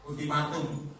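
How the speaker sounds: ordinary talk, nothing marked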